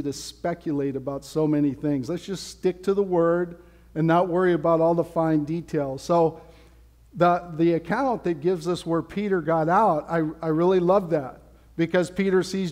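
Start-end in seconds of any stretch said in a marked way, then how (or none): none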